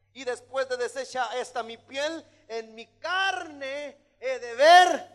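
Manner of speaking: high declamation swelling to a loud, drawn-out finish; dramatic preaching. Acted. Preacher as Job foreseeing God, swelling dramatic preaching. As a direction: start held back, then proclaim slowly and loudly.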